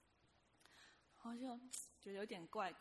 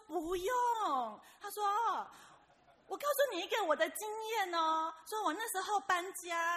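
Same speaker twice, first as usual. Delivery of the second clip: louder, higher, brushing her off; insistent salesman; 8 semitones higher, 11 dB louder than usual. Retold or imitated. Supervisor dismissing the narrator's refusal, loud and high. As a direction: talk over her objection.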